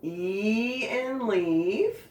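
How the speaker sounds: very slow, stretched phrase; drawn out. Shown as a demonstration. Teacher slowly sings out the vowel rule while writing. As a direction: stretch each word out. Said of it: "write them this way".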